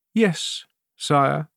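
high, subdued assent; submissive queen. A narrator's reading. Queen agreeing to appear before the king, submissive.

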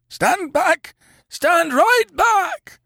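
very high, strained shouted command; sharp, imperious. A narrator's reading. Sick man warning his friend away, imperiously. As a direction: order him back with sudden force. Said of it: "with a sharp imperiousness".

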